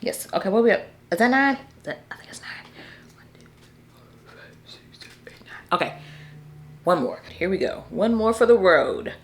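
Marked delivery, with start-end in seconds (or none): 1.6-5.7 counting under her breath, unvoiced; whispered counting. Real, not acted. Counting book picks quietly to herself, whispered.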